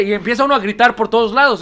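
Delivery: ordinary talk, nothing marked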